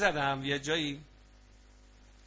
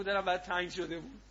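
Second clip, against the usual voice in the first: a soft, put-on sweet line; sweet pretend line; 3 semitones higher, 4 dB quieter than usual. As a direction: say it with a smile, playacting. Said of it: "فیلم بازی کن، لبخند بزن، بگو".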